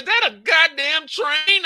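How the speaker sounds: high, loud and slow disbelief; alarmed. Retold or imitated. Passenger in the back seat asking, high and alarmed. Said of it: "I'm like".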